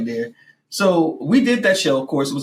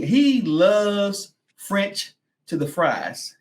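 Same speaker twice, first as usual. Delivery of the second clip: very slow stretched emphasis; 5 semitones higher, much slower than usual. Pretend. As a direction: draw every word out long.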